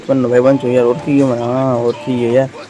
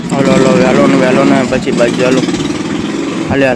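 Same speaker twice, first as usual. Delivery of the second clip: louder, pushing everyone to get moving; loud urging; 5 dB louder than usual. Acted. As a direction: call them along, loudly. Urging friends to come along. (જોરથી બૂમ પાડીને બોલાવવું)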